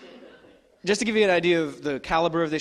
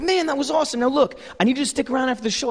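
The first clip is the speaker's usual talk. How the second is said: much higher, fast, pumped-up voice; excited impression; 9 semitones higher, 3 dB louder, faster than usual. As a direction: rattle it off like an overexcited host. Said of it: "Jerry just starts gushing".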